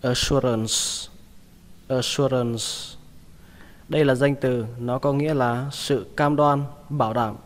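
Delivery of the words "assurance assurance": "Assurance" with its S sound kept clear; final S. Shown as a demonstration. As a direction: remember the S in "Assurance". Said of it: "Các bạn nhớ chữ sờ nhé".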